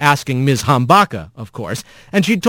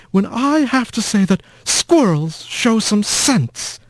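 slow, sour and higher, a lightly voiced prim old mouse; sour old aunt; 8 semitones higher, slower than usual. A narrator's reading. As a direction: drag out the words with sour disdain. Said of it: "a sour and all too familiar voice said".

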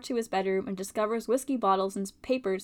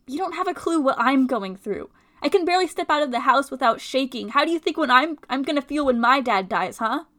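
louder, rising, heated; angry retort; 7 dB louder than usual. Acted. Friend throwing Owen's line back at him, angry and loud.

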